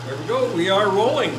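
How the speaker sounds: high, thrilled cheer; excited. Real, not acted. Motor starts running for the first time.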